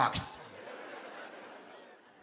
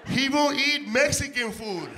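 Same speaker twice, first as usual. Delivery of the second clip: loud, high comic yell; loud laughing rant; shouting punchline; 8 semitones higher, 3 dB louder than usual. Real, not acted. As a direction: belt the punchline out.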